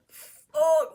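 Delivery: a sudden high, loud curse of panic; mock panicked yelp. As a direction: blurt it out in alarm. Aristagoras panics at revolt.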